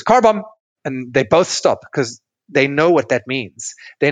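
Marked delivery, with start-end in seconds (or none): 0.0-0.4 a sudden high, loud call of the code word. Retold or imitated. Partner calls out the fight's code name, loud and high. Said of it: "the first person to realize goes".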